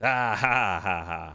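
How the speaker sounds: triumphant laugh. Real, not acted. Wins the game, gloats.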